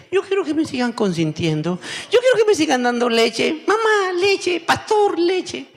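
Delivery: building from a pouty plea to a high wailing whine; childish whine that builds up high; whiny spoiled believer. Retold or imitated. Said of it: "y dice".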